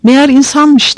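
high-pitched, mildly alarmed; fearful realization. A narrator's reading. Weaver backing away in fear, alarmed realization. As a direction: let a touch of fear lift the pitch. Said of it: "korku içinde geri geri çekilirken".

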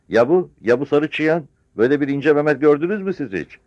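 high, loud challenge; scornful village woman. Acted. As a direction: demand an answer from the crowd. (yüksek sesle soran yaşlı kadın)

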